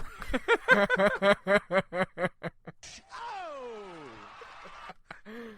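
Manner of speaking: drawn-out helpless laughing fit; long laugh. Real, not acted. Host cracking up over the bathroom question, long laugh. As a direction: crack up and keep going.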